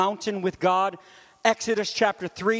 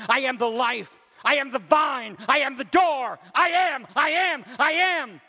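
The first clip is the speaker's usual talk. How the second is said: climbs higher and louder, ending in a full shout; building to a shout; 3 semitones higher, 4 dB louder than usual. Acted. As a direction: build each I am until you shout. Preaching climax, repeated I am.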